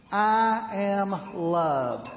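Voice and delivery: very slow I am loved; very slow declaration. Shown as a demonstration. Pastor leading the congregation in a slow declaration. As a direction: draw out each word. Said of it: "Say that with me".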